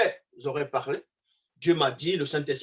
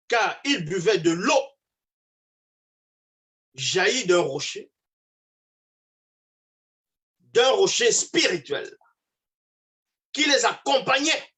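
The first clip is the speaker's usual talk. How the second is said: slow, raised preacher's reading with long pauses; declaimed scripture reading; 6 semitones higher, 4 dB louder, slower than usual. Acted. Preacher reading Corinthiens to prove his point, declaimed. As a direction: build the verse phrase by phrase to a high finish.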